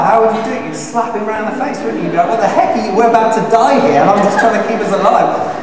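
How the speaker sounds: loud, high, urgent preaching; fired-up preacher; preaching at full force. Acted. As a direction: build it to a passionate peak.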